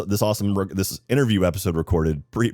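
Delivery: ordinary delivery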